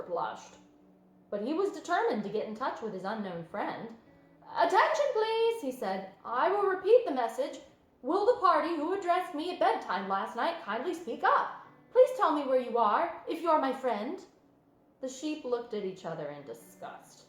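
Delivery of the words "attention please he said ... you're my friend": loud announcement. A narrator's reading. Wilbur repeats his announcement.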